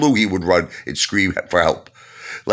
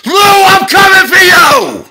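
huge slow bellow, much higher and louder; menacing shout; 12 dB louder, slower than usual. Shown as a demonstration. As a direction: roar it across the park to scare him. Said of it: "I just did this".

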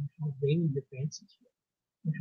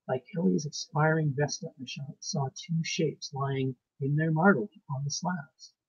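ordinary delivery

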